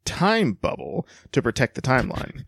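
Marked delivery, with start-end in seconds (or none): 0.0-1.1 TIME BUBBLE set apart as if in quotation marks; audible air quotes. Shown as a demonstration. Co-host reads a silly sci-fi term, air-quote inflection. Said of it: "I heard the time quotes".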